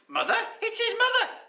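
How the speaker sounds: high, loud, astonished squeal; piercing surprised exclamation; amazed crowd. A narrator's reading. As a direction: exclaim in disbelief as an onlooker.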